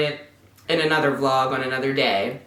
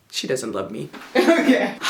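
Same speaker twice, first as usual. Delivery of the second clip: laughing; 2 semitones lower than usual. Real, not acted. Laughing at kitten.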